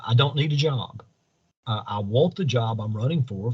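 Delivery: ordinary delivery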